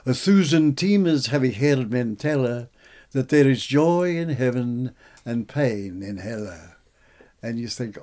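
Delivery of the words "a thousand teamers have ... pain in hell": louder, slowing recital of Chaucer's verse; savouring a line of Chaucer aloud; reciting Chaucer. Retold or imitated. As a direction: lift it into an unhurried recital. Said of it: "and he says".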